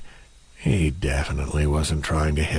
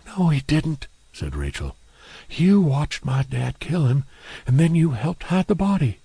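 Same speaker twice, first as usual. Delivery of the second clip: higher woman's voice, accusing; Rachel accusing; 8 semitones higher than usual. Acted. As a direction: call out her father's friends.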